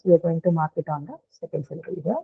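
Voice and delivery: ordinary delivery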